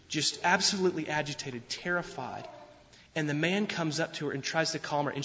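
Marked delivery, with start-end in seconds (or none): none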